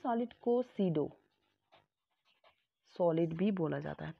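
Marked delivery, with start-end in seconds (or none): none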